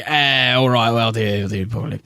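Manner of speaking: put-on a light Australian accent, trailing away into a mumble; mock Australian accent. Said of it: "I need to do an Australian accent".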